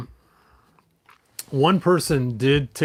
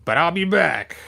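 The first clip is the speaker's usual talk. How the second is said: Skeletor voice; 6 semitones higher, 3 dB louder than usual. Acted. Skeletor taunting He-Man while retreating, higher villain voice. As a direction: spit it out like a beaten villain who swears revenge. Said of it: "Skeletor said".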